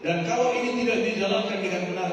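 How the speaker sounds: loud, driving sermon voice; fiery preaching. Acted. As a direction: hammer the point home to the congregation. Preaching at full force.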